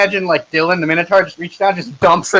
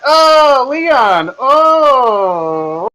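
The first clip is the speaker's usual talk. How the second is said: whiny magistrate; 9 semitones higher, 4 dB louder, much slower than usual. Acted. Magistrate voiced in story.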